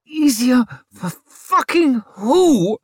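high, loud, drawn-out outburst; furious scream. A narrator's reading. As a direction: scream it slowly in pained outrage. Woman in labour snaps at surgeon.

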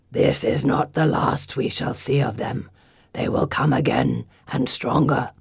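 very low stone-creature voice; grave gargoyle warning. A narrator's reading. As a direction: warn in a deep gargoyle rumble. Gargoyle warns of enemy's return.

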